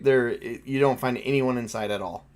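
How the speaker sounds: ordinary talk, nothing marked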